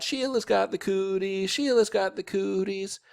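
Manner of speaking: high, sing-song playground jeer; hollered taunt. A narrator's reading. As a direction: holler it like teasing kids. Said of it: "We hollered really loud".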